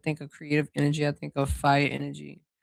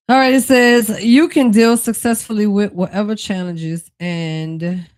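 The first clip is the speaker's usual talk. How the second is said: energized reading; 6 semitones higher, 9 dB louder than usual. Real, not acted. Reads card aloud with energy.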